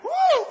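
high, loud woo; exultant whoop. Real, not acted. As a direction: let out a joyful holler. Preacher's exultant cry.

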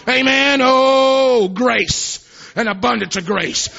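high, loud shouted preaching; exultant revival preaching; preaching at full force. Acted. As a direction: proclaim it like a revival climax.

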